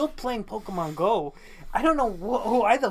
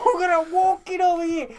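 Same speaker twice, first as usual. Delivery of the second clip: higher, louder mock New York accent; hammed-up New York accent; knockoff accent; 11 semitones higher, 8 dB louder than usual. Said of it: "I'm just doing the knockoff".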